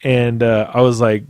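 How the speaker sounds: usual speaking voice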